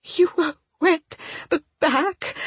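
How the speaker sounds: high, broken, halting sob; sobbing. A narrator's reading. Woman overwhelmed that he went back, sobbing. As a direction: sob it out, stumbling on the words. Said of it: "I sob".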